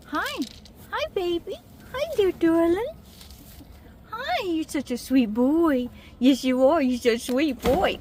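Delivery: high cooing pet talk. Real, not acted. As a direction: coo sweetly at the bunny. Baby talk to pet rabbit.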